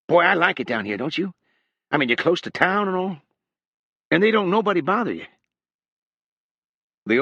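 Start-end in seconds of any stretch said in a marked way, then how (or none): 0.1-5.3 higher, nervous chatter, running on; a jittery kid talking too much; eager young drifter. A narrator's reading. Young man making small talk to the hog's owner, higher and eager.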